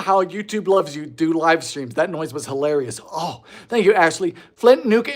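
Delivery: ordinary talk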